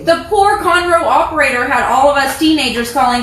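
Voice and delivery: usual speaking voice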